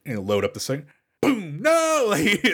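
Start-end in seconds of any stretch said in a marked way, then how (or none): 1.2-1.9 mimicked boom, then mock dramatic cry; a comic gun blast and a high wail; delayed gunshot imitation. Shown as a demonstration. Showing the delay in the drama, mock boom and wail. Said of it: "there's a real delay in the drama".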